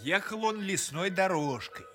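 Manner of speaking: plain reading voice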